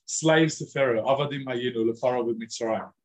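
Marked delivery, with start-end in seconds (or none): none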